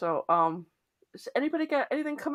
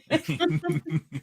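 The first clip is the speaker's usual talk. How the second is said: laughs through very; giggling the word Very; laughing; 7 semitones higher than usual. Real, not acted. Repeats very interesting, laughing.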